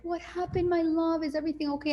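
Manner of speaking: high, tender mothering voice; tender to child. Retold or imitated. Mother soothing her child after a bad school day, tender and high.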